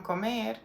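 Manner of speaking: comer with the stress on the final "-mer"; stress on last syllable. Shown as a demonstration. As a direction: hit the second syllable of "comer". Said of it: "falls on the last syllable".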